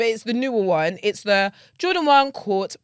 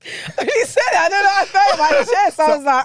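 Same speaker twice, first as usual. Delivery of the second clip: excited; 9 semitones higher, 6 dB louder than usual. Real, not acted. High laughing excitement.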